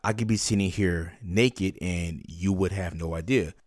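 usual speaking voice

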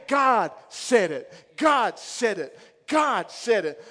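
God said it, slow with stress on God; slow declaration. Real, not acted. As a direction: hammer God each time. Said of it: "repeated declaration".

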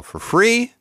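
incredulous rising question; mock outrage. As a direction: act shocked at the idea. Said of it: "I don't think so".

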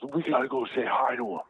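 high, eager imitation of an excited fan; retold giddy insistence; starstruck urging. Starstruck brother urging him along.

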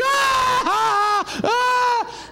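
repeated high imitated baby wails; screaming infant. Dad mimicking his bitten baby, screaming over and over. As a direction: wail like a baby with two mouths. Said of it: "looked like he had two mouths".

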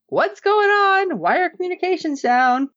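a commander realising the comms are dead; alarmed. Retold or imitated. Villain confused by lost communications, high alarmed questions. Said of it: "Jax is like".

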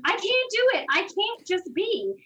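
high, slow whiny protest; anxious student begging the yoga teacher, high and slow; mock protest. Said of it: "And I kept saying".

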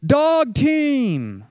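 high, loud cry of discovery; alarmed shout. Retold or imitated. Spotting a second abandoned team in the storm, shouting. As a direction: call it out over the wind.

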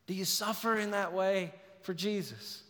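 ordinary delivery

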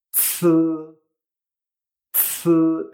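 z as "tsʰ" with a burst of air; z hissed out as a strongly aspirated ts; aspirated Chinese C. Shown as a demonstration. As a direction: explode air through the ts of z, tongue up. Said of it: "el mismo sonido con aire".

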